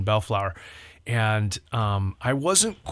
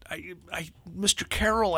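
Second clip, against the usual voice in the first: high, soft, stammering reply; acted timid kid, faltering over the words; hesitant young boy; 6 semitones higher, 5 dB quieter than usual. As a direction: play a small boy stuck for an answer. Boy stammering unsure to leader.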